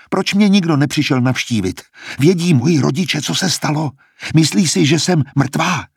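agitated, high-pitched pleading; distraught questioning. A narrator's reading. Poisoned Klemencie questions her visited schoolmate, distraught and high. Frightened patient demands answers.

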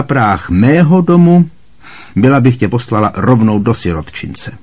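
loud, high scornful rant; scornful Aunt Marge. A narrator's reading. Aunt Marge scorning Harry over tea, loud and contemptuous. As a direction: lecture him with contempt.

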